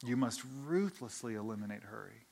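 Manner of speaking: soft, low, deliberate imitation of a mentor; very calm. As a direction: repeat the advice calmly and gravely. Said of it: "he says very calmly".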